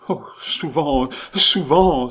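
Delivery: loud, high anguished exclamation; crying out. A narrator's reading. Patient confessing thoughts of killing his wife, crying out. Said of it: "s'écria le malade".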